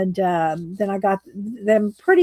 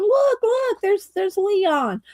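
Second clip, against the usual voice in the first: much higher squeaky mouse, slowing on the name; excited mouse; 10 semitones higher, slower than usual. Acted. Mouse spots Leon excitedly.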